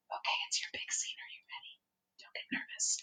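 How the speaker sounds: soft quick backstage whisper. Real, not acted. Someone whispering encouragement to the reader, soft. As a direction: whisper an aside off mic.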